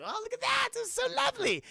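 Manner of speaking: very high, gleeful creature squeal; delighted villain. Retold or imitated. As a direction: coo over a nasty treasure. Acts out villain admiring scorpion.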